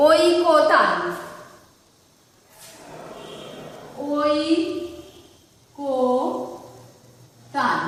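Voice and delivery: ঐকতান drawn out slowly as oi-ko-tan; ঐকতান ধীরে টেনে উচ্চারণ; pronunciation demo. Shown as a demonstration. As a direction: stretch ঐকতান syllable by syllable. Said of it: "উচ্চারণ করো".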